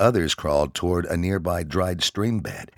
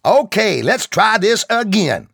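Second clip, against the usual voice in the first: higher, louder sergeant's yell; 9 dB louder than usual. Acted. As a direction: yell it across the range. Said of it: "the sergeant yelled".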